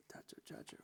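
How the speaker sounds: breathy whispered cha-cha, cha-cha, barely voiced; whispered rhythm. Shown as a demonstration. Drum teacher whispers the rhythm to students, hushed. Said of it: "because it gives it shape".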